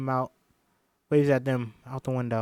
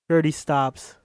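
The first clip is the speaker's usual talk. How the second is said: usual speaking voice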